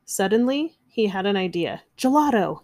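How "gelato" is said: higher, narrated excited cry; mild yell. A narrator's reading. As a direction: call it out with a sudden burst, storybook style. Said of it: "he yelled".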